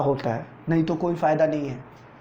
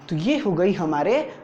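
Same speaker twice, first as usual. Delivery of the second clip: usual speaking voice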